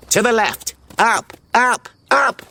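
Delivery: high, urgent cried directions; crying out. A narrator's reading. Brainy spots an escape route, crying out. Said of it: "Brainy cried out".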